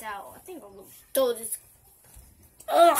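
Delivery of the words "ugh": loud disgusted groan. Real, not acted.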